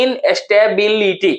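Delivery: "इनस्टेबिलिटी" slowly as इन-स्टे-बिल-ई-टी; syllable by syllable. Shown as a demonstration. Teacher splitting for students to count, slow syllables. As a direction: separate every syllable of "इनस्टेबिलिटी".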